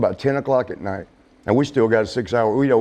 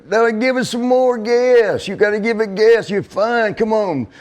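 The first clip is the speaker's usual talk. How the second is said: pushy boss; 9 semitones higher than usual. Retold or imitated. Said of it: "And he goes".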